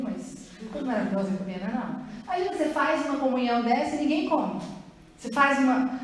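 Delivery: usual speaking voice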